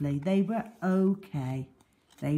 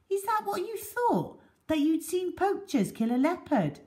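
higher, lightly amused ranger; a hint of an amused ranger; bearded man, amused; 14 semitones higher than usual. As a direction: tease them gently about their mistake.

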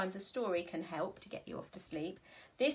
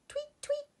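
very high chirpy tweet; birds tweeting. Acted. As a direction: chirp like a little bird. Birds in story.

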